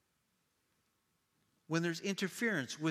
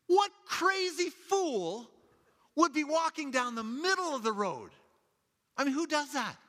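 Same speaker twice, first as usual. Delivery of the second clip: indignant; 13 semitones higher, 6 dB louder than usual. Retold or imitated. Mom fuming at the man in the road, high and scornful. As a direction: scoff at the fool in the road. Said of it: "And she goes".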